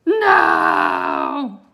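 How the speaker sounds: a huge raging roar of No. Acted. Wizard discovers the eye is useless, roaring. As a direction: bellow it out with full force. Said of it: "and roared".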